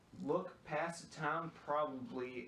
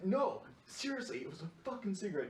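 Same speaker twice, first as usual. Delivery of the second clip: imitated indignant protest; 7 semitones higher than usual. Son protesting to his mom.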